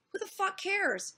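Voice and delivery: high-pitched mock-incredulous question; retold dismissiveness. Streamer quoting Bering dismissing the complaint, incredulous. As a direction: imitate him brushing it all off, pitch up. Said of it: "that was his attitude".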